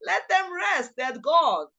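high-pitched mock exasperation; retold exasperation, jumping up high; exasperated inner remark. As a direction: imitate a friend silently pleading, voiced high. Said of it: "she always said that to herself".

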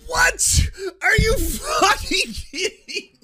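shouts in disbelief, high and loud; shocked outburst. Real, not acted. Reacting to a clip of the hosts, shocked laughing shout. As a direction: blurts out, laughing at the absurdity.

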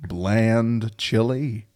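put-on slow, over-careful Middle West accent; mock Middle West accent. Host parodying a Midwesterner, slow mock Middle West accent. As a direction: spell out each word as a stiff Midwesterner. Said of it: "I am from the Middle West".

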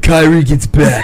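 lightly voiced slow surfer dude; a hint of a laid-back surfer bro. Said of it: "surfer voice for the Nets".

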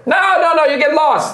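high, loud halfling voice; halfling worker. Acted. Halfling worker hails visiting strangers, high shouted voice. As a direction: call out high over factory din. Halfling refuses over workshop noise.

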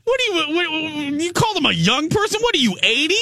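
high, incredulous teasing; mock outrage. Real, not acted. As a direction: act shocked, voice way up. Teasing disbelief at co-host.

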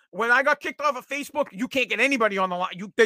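normal talking voice

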